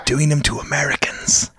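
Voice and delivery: hushed whisper, drawn out; slow, secretive whisper; confiding whisper. Pretend.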